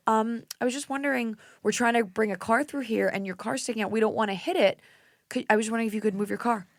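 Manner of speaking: high, tentative asking voice; re-enacted careful, apologetic request; polite request. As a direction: tiptoe politely into asking a favor. Re-enacts timid request to neighbor.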